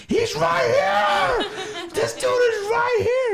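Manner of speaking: high, drawn-out top-of-the-lungs scream. Retold or imitated. Trying to get the stage to notice his friend, screaming. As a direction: scream it over a huge crowd. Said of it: "screaming at the top of my lungs".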